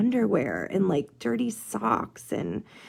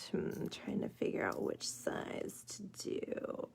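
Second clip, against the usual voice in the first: whisper; 14 dB quieter than usual. Real, not acted. Murmuring to herself while deciding.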